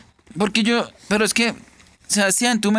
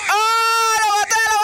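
high, thrilled outburst; elated cry; 10 semitones higher than usual. Real, not acted. Player celebrates finally killing an enemy, elated cry.

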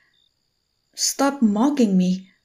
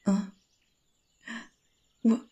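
normal talking voice

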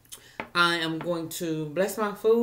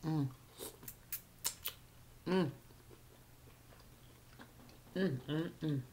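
humming while chewing mussel; closed-mouth chewing murmur; mouth full, chewing; 3 semitones lower, 14 dB quieter than usual. Real, not acted. Seen 4: Puts mussel in mouth, then chews with lips closed while humming.

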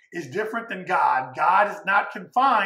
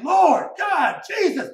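earnest pleading prayer; 8 semitones higher, slower than usual. Retold or imitated. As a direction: pour your heart out calling on God. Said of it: "you're praying".

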